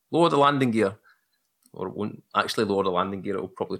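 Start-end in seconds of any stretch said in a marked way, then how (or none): 0.1-1.0 raised, clipped command to software. Retold or imitated. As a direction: bark it like a cockpit order. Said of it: "I'm saying things to it like".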